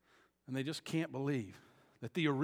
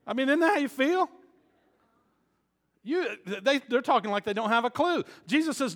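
loud, higher preaching voice; preaching at full force; 10 semitones higher, 15 dB louder than usual. Acted. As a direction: preach it hard to the congregation.

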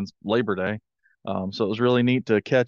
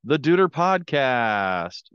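grand entrance announcement; 3 semitones higher than usual. Acted. Podcast host opens the show.

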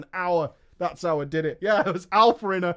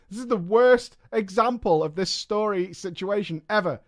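normal talking voice

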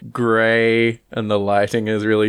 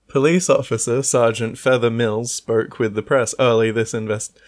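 ordinary delivery

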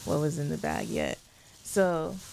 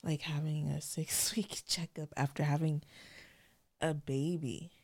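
whispered through tears with a small laugh; fighting back crying; tearful whisper; 6 dB quieter than usual. Real, not acted. Recalling her last midwife checkup, whispering tearfully. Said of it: "I'm about to cry".

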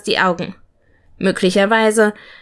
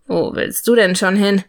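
mildly drowsy mumble; understated sleepy question; sleepy murmur. A narrator's reading. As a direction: ask as if barely awake. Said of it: "murmelte er verschlafen".

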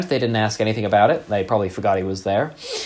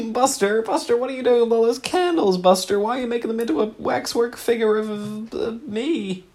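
silly character voice; 12 semitones higher than usual. Pretend. Improv partner chiding Buster in a very high prim voice. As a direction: coo it like a fussy old dear.

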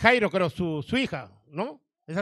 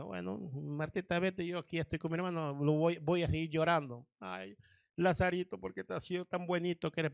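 softer, lamenting, half-weeping tone; a hint of sobbing grief over Lazarito; lightly voiced mourning sister; 3 semitones lower, 7 dB quieter than usual. María staying home to keep crying for her brother, softly lamenting.

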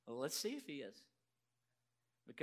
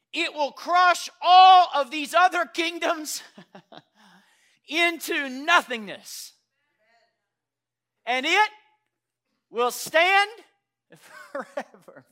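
loud, slow proclamation broken by laughs; booming scripture with laughter; 26 dB louder, slower than usual. Acted. Pastor gleefully booming the verse to the congregation. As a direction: relish each word of the prophecy. Reading scripture at full force.

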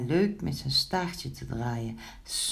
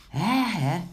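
high, relieved sigh; 4 semitones higher, 4 dB louder than usual. A narrator's reading. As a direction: let out a storybook sigh of relief. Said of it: "zuchtte Pinkeltje". (opgelucht zuchtend)

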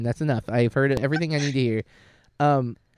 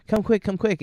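mildly frantic, higher-pitched mock alarm; retold freak-out; 9 semitones higher than usual. Retelling the alarmed phone call from the pit, mock frantic. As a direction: imitate an urgent call for help. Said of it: "[name] is freaking out".

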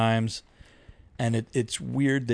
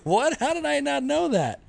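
high, squeaky disbelief; much higher, baffled cry; retold shock. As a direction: act stunned at the news. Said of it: "I was like".

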